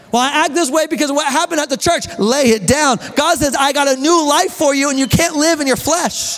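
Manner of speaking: high, forceful pulpit delivery; fervent back-and-forth sermon exchange; impassioned preaching. Real, not acted. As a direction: drive the point home like a fired-up preacher.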